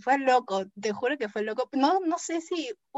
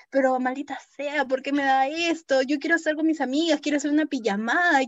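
higher, louder and fast complaining; teen girl venting about her illness, higher, louder, fast; teen frustration; 5 semitones higher, 4 dB louder than usual. Retold or imitated. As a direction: vent like a fed-up teenager.

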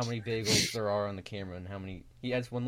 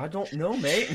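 mock British, pitched up high; fake British accent; 6 semitones higher, slower than usual. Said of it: "this guy with this fake British accent".

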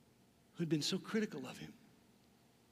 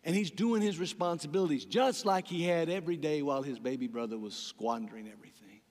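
ordinary talk, nothing marked

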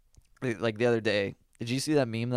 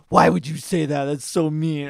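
raised indignant mom voice; louder quoted mom; 4 semitones higher, 8 dB louder than usual. Retold or imitated. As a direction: scold like an offended mom. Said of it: "and she's like".

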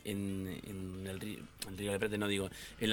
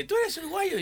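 Spanish shopkeeper; 4 dB louder than usual. Retold or imitated. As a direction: squeak it like the Spanish grocer. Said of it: "y dice".

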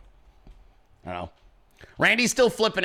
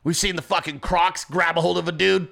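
ordinary talk

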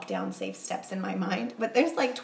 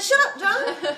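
indignant retort; 13 semitones higher, 7 dB louder, slower than usual. Retold or imitated. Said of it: "And I said".

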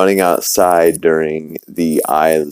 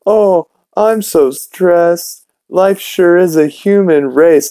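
high, slow little puppet voice; rat puppet voice; 12 semitones higher, slower than usual. Acted. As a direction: make the dead rat talk in a tiny high voice. Dead rat puppet talking.

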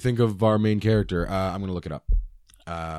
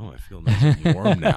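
higher, louder, put-on cozy voice; cozy content voice; 6 semitones higher, 5 dB louder than usual. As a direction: sigh it out, contented. Quoting himself warm in cold.

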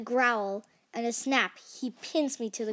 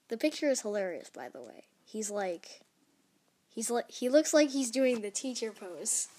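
usual speaking voice

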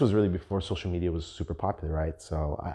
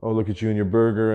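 mock whining; 3 semitones higher, 5 dB louder than usual. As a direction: put on a petty, resentful taunt. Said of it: "I know I'm sounding very".

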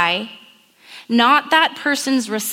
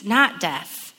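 usual speaking voice

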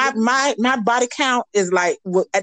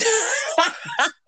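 a high burst of real laughter; 4 dB quieter than usual. Real, not acted. Guest laughing at the host's cheeky phrasing, high laugh.

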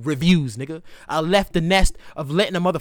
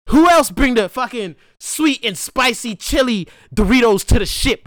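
ordinary talk, nothing marked

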